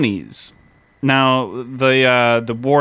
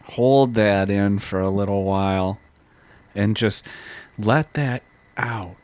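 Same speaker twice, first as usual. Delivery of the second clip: relaxation guide. Pretend.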